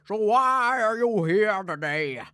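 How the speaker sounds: slow, loud judge voice; slow, loud courtroom question; stern judge. Retold or imitated. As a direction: ask it slowly like a booming judge.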